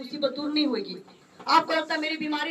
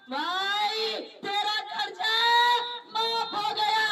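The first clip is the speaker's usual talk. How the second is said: joyful news; 6 semitones higher, 3 dB louder, slower than usual. Retold or imitated. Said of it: "उसको call आया".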